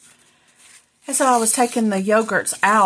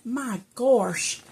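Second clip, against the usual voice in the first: ordinary talk, nothing marked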